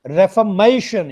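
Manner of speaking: "Reformation" as ref-uh-MAY-shun; stress before shun. Shown as a demonstration. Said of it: "stress हमेशा शन से पहले वाले सिलेबल पे आना".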